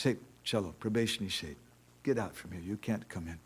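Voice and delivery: low, soft acted dismissal; understated annoyance. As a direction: send them away quietly, only mildly annoyed. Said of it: "Radharani becomes very, very annoyed".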